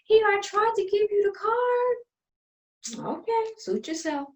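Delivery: high, sing-song condescension; mock sweet. Sister offering therapist card, condescending. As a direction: patronize her sweetly.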